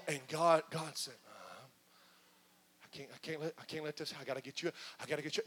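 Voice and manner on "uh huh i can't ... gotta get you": hushed, low and firm; God softly insisting on rescue; quiet insistence. Acted. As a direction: murmur it, tender but determined. God refusing to let go.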